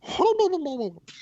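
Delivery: short high burst of babble; mock Spanish gibberish. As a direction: imitate foreign chatter with nonsense syllables. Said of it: "and be like".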